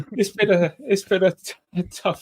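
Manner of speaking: laughing it out, high and loud; laughing through relief. Real, not acted.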